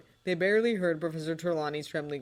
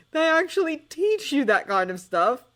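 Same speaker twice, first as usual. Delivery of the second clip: incredulous; 11 semitones higher, 9 dB louder than usual. Acted. John disbelieving.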